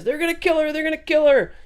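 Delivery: very high-pitched panicked mock cry; frantic warning in a squeaky put-on voice; hammed-up high-pitched alarm; Quicksilver. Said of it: "Quicksilver runs over to Magneto".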